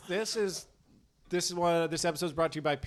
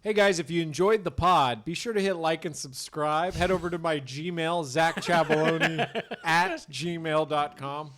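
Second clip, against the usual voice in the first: hammed-up podcast ad read; upbeat sponsor plug; mock ad read; 5 dB louder, slower than usual.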